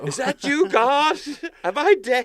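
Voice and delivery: very high, dazed little voice; stoned dog's voice. Retold or imitated. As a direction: voice the confused dog seeing God. Voicing the stoned dog.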